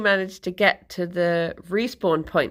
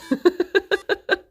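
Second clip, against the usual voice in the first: high-pitched burst of laughter; 11 semitones higher than usual. Real, not acted. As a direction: crack up at your own joke. Cracks up at own joke.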